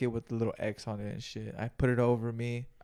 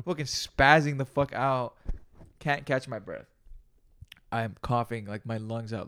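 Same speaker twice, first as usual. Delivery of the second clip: usual speaking voice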